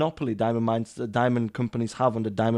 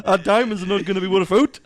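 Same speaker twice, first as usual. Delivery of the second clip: high South African attempt straying towards Yorkshire, through laughter; badly faked South African accent, nearly cracking up; comically wrong South African accent; botched South African accent; 6 semitones higher, 4 dB louder than usual. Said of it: "He's not Yorkshire".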